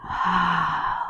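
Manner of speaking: soft, long sigh; audible exhale. Shown as a demonstration. Guide breathes with the arm stretch, soft sigh.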